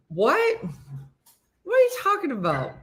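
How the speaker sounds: high, fast disbelief; what on earth, quick; surprised. Real, not acted. Reacting to a joke.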